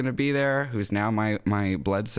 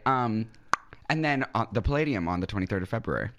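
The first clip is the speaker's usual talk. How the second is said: normal talking voice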